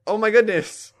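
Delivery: high, loud burst of surprise; excited exclamation. Retold or imitated. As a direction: gush like you just got your wish. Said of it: "and I was like".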